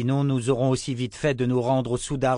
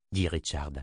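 ordinary delivery, nothing marked

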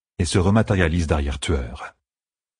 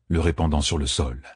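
ordinary delivery

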